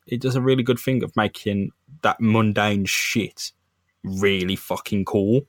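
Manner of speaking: usual speaking voice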